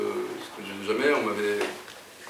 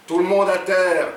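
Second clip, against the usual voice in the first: firm, raised robber's order; repeated command; 8 semitones higher, 7 dB louder than usual. A narrator's reading. Jean repeats his friend's order to customers, commanding. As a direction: call the order out clearly, higher and louder. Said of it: "Il a dit".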